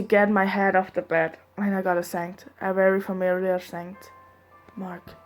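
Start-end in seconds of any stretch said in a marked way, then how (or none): none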